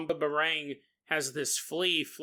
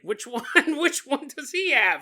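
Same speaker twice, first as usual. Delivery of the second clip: laughing witch; 8 semitones higher, 4 dB louder than usual. Acted. Witch flicking spider legs, quoted.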